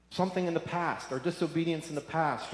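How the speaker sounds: usual speaking voice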